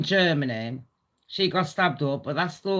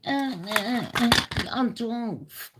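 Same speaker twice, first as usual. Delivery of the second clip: mocking voice; 5 semitones higher than usual. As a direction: mimic a dim accuser. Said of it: "anyone online wanna sit there going".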